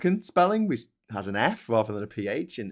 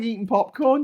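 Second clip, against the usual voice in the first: normal talking voice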